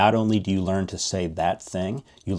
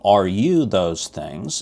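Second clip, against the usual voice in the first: normal talking voice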